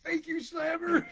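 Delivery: high, drawn-out squeal of thanks; mock excited cry. A shopper thanks the wrestler, mock high-pitched cry. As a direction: call it out high and slow, hammed-up.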